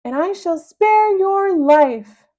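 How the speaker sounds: high, loud, slow villain command; a tyrant villain's booming order. Acted. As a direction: lord it over him. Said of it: "he commanded".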